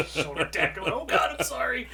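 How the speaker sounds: laughing mock apology. Acting out tackling Casey.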